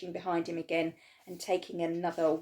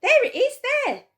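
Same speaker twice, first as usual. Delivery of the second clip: high, loud pointing shout; 12 dB louder than usual. A narrator's reading. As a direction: yell it out over everyone. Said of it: "someone else shouted".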